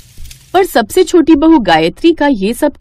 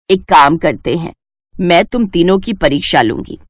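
lower, measured mother-in-law voice; stern mother-in-law; 6 semitones lower than usual. Acted. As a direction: speak as the wise old mother-in-law, firm and knowing. Mother-in-law sets the test. (धीमी, गंभीर सास की आवाज़)

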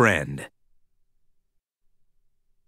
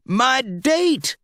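excited exclamation, higher pitch; exclaimed; 9 semitones higher than usual. Acted. Mater spotting his date on the jet, excited. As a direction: blurt it out happily. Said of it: "Mater exclaimed".

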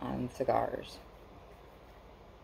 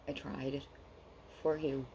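usual speaking voice

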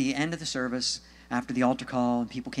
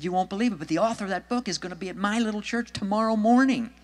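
high, insistent retold plea; excited urging; 7 semitones higher, 3 dB louder than usual. Woman urging her suicidal friend to wait, excited. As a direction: act out urgent good news, pitched up.

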